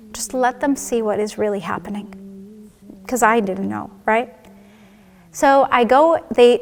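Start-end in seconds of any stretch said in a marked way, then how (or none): 0.1-2.7 breathless whispered plea to the Lord; whispered prayer. Retold or imitated. Praying on the gurney for the doctors to see, whispered. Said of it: "I prayed".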